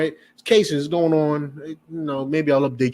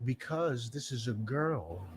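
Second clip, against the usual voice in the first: quiet reply; 4 semitones lower, 13 dB quieter, slower than usual. Retold or imitated. School staff answering father.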